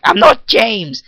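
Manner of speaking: high-pitched exasperated outburst; exasperated protest. Retold or imitated. Correcting a stranger who keeps calling him James, exasperated protest. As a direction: insist on it, voice jumping up in frustration. Exasperated repeated denial of the name.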